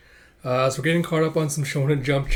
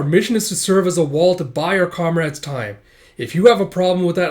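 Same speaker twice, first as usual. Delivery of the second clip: loud, raised-pitch rebuke; stern commander; 5 semitones higher, 6 dB louder than usual. Acted. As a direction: lay down the law to a soldier. Captain rebukes soldier.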